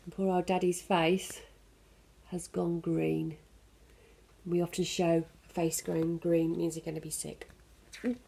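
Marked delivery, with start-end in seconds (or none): none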